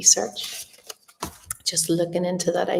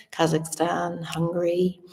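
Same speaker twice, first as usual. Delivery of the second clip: usual speaking voice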